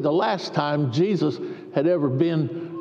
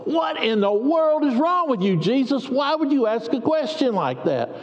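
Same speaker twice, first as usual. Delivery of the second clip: higher, put-out voice of the lame man; indignant man; 6 semitones higher than usual. Acted. Lame man snapping back at Jesus, indignant and higher. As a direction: bristle at a silly question.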